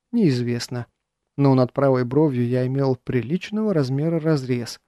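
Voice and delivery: ordinary delivery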